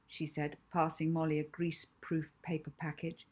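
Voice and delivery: plain reading voice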